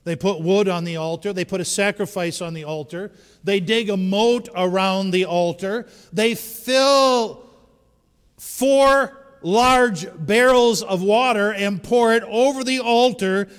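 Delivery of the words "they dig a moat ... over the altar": loud driving sermon cadence; preacher loudly driving the story to the congregation; preaching at full force. Acted. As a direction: pound out each step. Preaching the altar drenching.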